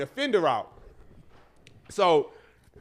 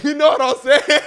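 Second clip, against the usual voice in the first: louder, higher, laughing as you mock it; laughing mockery; 7 semitones higher, 8 dB louder than usual. Real, not acted. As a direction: throw the phrase back with a laugh. Mocking friend's filler phrase.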